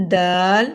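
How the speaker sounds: دال with a firm stopped d, long vowel; hard dal. Shown as a demonstration. Teacher contrasts two letters, دال with a plain hard d. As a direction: hold دال long after a clean d. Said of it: "زال ہے یہاں پر".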